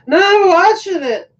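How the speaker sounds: high, loud, drawn-out imitation of wife; mock stubborn protest. Imitates sleepy wife protesting to husband.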